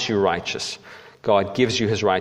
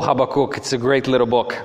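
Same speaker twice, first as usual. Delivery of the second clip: usual speaking voice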